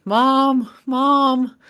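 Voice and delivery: put-on high, loud child's call; crying out for Mom. Little girl in tears calls her mom, high cry. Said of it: "she cries out for her mom".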